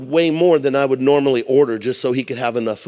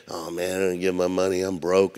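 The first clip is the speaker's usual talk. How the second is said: softer, lower reluctant grumble; a giver who would rather keep his money; whiny giver; 6 semitones lower, 7 dB quieter than usual. Pretend. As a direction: mumble it like you begrudge every cent.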